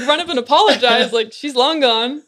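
ordinary talk, nothing marked

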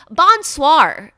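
"Bonsoir" belted out with the final R pronounced; "Bonsoir" loud and proud, with a hard American R; mock tourist "Bonsoir" with the R sounded. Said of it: "they don't pronounce the R".

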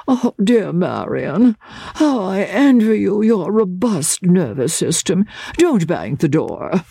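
high, slow, drawling fretful gentleman; languid invalid uncle. A narrator's reading. Idle uncle lolling on his footstool, languid drawl. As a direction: lazily sigh it out from the footstool. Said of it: "stretched out his lazy legs".

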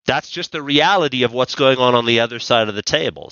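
ordinary delivery

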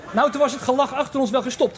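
ordinary talk, nothing marked

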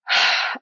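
audible exhaled sigh; resetting sigh. Shown as a demonstration. Showing how she comes home through breath, a settling sigh. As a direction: let out a breath to settle yourself.

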